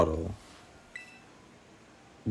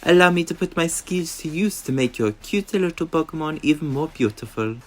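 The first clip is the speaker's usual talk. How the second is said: high, put-on French accent for the gym leader; theatrical French makeup artist; 7 semitones higher than usual. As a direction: lay on a fancy French accent. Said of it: "that terrible accent that I just did".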